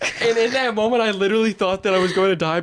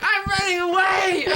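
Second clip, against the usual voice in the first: put-on high, drawn-out child's cry; childish wail; 13 semitones higher than usual. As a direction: whine it like a runaway kid. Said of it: "I'm like".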